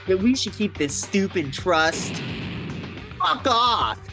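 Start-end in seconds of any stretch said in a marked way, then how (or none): 3.2-4.0 higher, angry outburst; angry shout. Acted. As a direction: snap it at them. Angry character lashes out.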